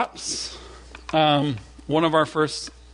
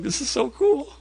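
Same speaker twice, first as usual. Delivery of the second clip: high-pitched retold thrill; excited; 9 semitones higher than usual. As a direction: marvel in a high, eager voice. Retells excitement taking bear pictures.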